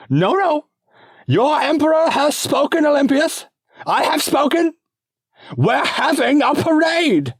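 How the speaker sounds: very high, loud and bossy; shrill imperial decree; petulant emperor. Acted. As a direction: stamp your foot like a spoiled child-king. Emperor overrules Olympius.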